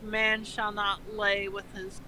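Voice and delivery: usual speaking voice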